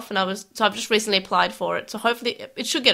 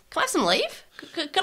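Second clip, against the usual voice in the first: higher-pitched hammed-up Australian accent; mock Australian accent; 7 semitones higher, 4 dB quieter, faster than usual. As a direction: beg the boss in a broad put-on Australian accent. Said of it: "I'm doing Australian".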